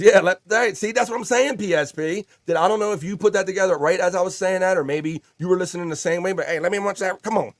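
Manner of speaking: playful excited chat voice; excited chat read. Retold or imitated. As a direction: read it like a hyped friend. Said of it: "Peter Scott Parker says".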